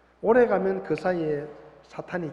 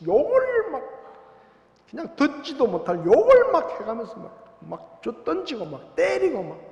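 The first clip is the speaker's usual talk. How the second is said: heated preaching; 8 semitones higher, 4 dB louder than usual. Acted. Describes raging churchgoer, loud.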